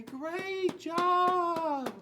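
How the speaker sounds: high, loud cheer; cheering classmates. Retold or imitated. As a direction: shout it out like an applauding class. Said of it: "and say".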